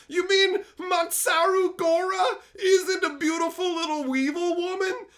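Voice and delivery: hammed-up high cute voice; mock high girl voice. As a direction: tease the viewers in a fake girly voice. Mocking viewers, high voice.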